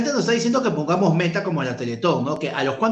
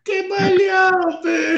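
teasing shout; 11 semitones higher, much slower than usual. Real, not acted.

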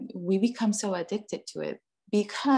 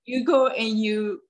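ordinary delivery

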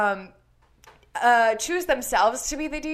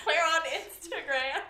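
higher, giggle-choked voice; breaking into laughter; 6 semitones higher, 6 dB quieter, slower than usual. Real, not acted. Podcast host losing it while giving the socials, laughing. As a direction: crack up halfway through the plug. Cracks up during the sign-off plugs.

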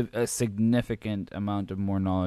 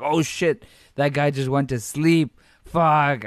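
excited; 4 semitones higher, 5 dB louder, slower than usual. Retold or imitated. Excited at a knockout.

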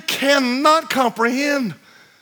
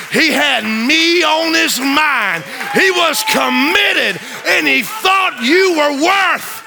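preaching at full force; 3 semitones higher, slower than usual. Acted. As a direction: shout it to the congregation like a revival preacher.

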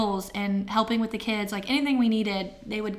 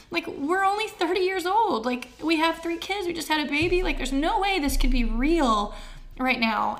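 higher-pitched, emotional pleading as you retell it; getting emotional; 6 semitones higher than usual. Retold or imitated. Wife calling mom after diagnosis, emotional disbelief. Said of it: "I'm gonna get emotional".

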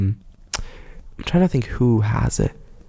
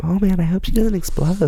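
higher-pitched mock concern; mock-worried radio listener, pitched up; worried late-night listener; 8 semitones higher than usual. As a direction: play an anxious listener fretting aloud. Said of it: "the people driving home at two A M".

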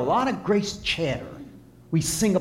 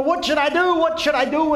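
much higher and louder, frantic; panicked officer; 13 semitones higher, 6 dB louder than usual. Acted. Officer fleeing a wild bull, yelling in panic to the farmer. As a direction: yell for help fleeing a bull. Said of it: "he says to the farmer".

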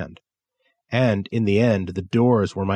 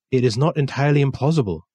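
put-on higher, quicker Japanese-accented voice for the old dealer; polite Japanese art dealer, higher and fast; 4 semitones higher, faster than usual. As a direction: lift into Kensuke's accented voice, brisk.

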